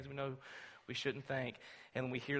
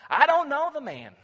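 desperate denial; 12 semitones higher, 12 dB louder than usual. Acted. Peter's third panicked denial.

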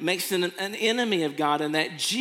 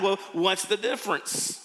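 usual speaking voice

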